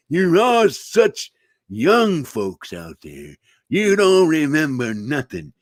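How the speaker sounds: put-on drawn-out old codger grumble; hammed-up old-timer scolding the young; cranky old-timer. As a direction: drag the words out like a crotchety elder.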